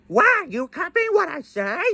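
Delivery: high squawky parrot copying the words; parrot mimicking. Parrot repeats the line.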